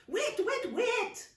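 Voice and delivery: high, urgent command to stop; ordering. Acted. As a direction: halt the grabbing kids firmly. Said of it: "Auntie May ordered".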